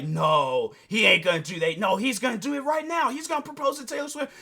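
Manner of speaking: rising from a shout into very high disbelief; the penny drops, voice climbs; a fan gasping that it's happening right now; excited realization. Retold or imitated. Viewer realizing the proposal.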